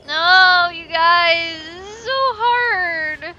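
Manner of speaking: slow, high, whimpering complaint; half-crying in frustration; frustrated whine. Real, not acted. Player struggling with the level, whiny wail.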